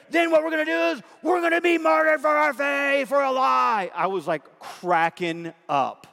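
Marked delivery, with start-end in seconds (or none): 0.1-3.9 excited high shout; disciples plotting. Retold or imitated. Pastor voicing disciples in a comedy video, excited shout. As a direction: shout the plan like giddy schemers. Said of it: "And then they're".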